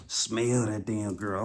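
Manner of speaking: ordinary delivery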